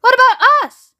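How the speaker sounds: high, loud and indignant; don't-leave-us-out yell; screamed protest. A narrator's reading. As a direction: shout it as an offended grandma. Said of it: "screamed Grandma Georgina".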